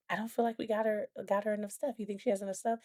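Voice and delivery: softly voiced worry; quietly imitated husband.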